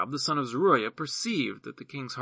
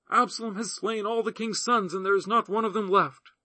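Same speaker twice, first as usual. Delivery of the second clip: much higher, urgent report of disaster; alarmed messenger; 8 semitones higher, 4 dB louder than usual. Acted. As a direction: blurt out the terrible news. Messenger brings terrible news.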